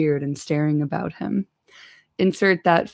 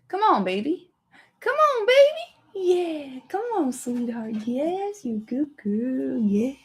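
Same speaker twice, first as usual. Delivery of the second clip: cooing to dog; 7 semitones higher than usual. Real, not acted.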